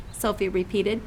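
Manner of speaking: ordinary delivery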